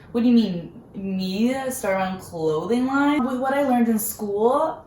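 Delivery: you can't believe what's being suggested; incredulous. Retold or imitated. To her cousin, doubting she could start a clothing line, incredulous. Retelling her own disbelief at idea.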